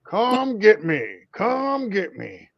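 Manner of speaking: teasing taunt, the second drawn out slow; playful come-and-get-me challenge. Acted. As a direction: dare them all to come at you. His taunting catchphrase.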